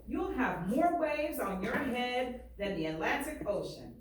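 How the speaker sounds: lively read-aloud, painting each picture; story reading. Acted. Teacher reading a barbershop story aloud to students, lively. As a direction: read it to the class with swagger and pride. Reads the book aloud to class.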